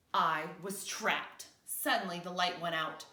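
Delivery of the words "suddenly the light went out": ordinary delivery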